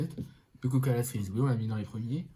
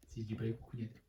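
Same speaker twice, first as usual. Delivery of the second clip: whispering; 8 dB quieter than usual. Real, not acted. Aparté coquin chuchoté.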